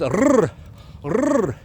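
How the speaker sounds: r as a long rolled trill; isolated R sound. Shown as a demonstration. Teacher demonstrating the Raider rune, trilled R. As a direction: roll each r strongly on its own. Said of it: "you know, the R sound".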